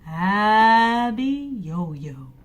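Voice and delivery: drawn out very slowly; slow ominous naming. Acted. Father telling son the giant's name, slow and ominous.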